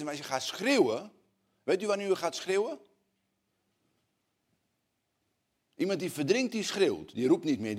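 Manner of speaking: high, loud urgent sermon; fervent preaching. Acted. Preacher explains crying out to God, fervent and loud. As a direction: drive it home with rising fervour.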